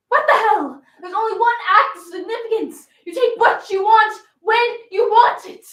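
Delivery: loud, high, rousing declaration; full-voiced reckless defiance; defiant outburst. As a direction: proclaim it like a rebel rallying a crowd. Rebellious flapper's outburst against convention.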